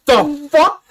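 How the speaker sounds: incredulous. Retold or imitated. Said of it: "my one dude was like".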